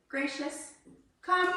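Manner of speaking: high bright call to a dog; calling command. Real, not acted. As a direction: call her over.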